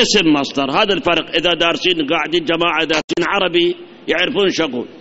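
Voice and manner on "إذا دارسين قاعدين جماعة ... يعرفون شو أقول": normal talking voice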